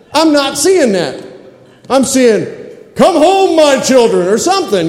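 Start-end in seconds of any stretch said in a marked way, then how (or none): 3.0-4.3 grand booming archangel call; big, booming. Acted. Archangel calling the children home, big booming voice. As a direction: call it out high and big, like a summons from heaven. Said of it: "something big and booming".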